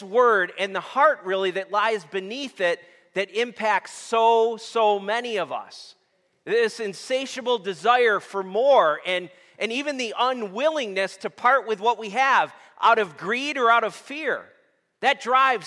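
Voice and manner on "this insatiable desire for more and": ordinary talk, nothing marked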